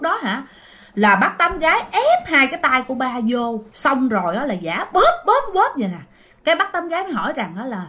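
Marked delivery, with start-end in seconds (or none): none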